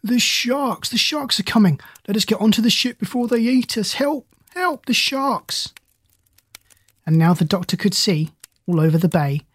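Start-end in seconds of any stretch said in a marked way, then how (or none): 0.0-5.7 thin-volume, high cries of alarm; crying out in fear. A narrator's reading. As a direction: cry out in alarm without shouting. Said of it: "cried out in great fear".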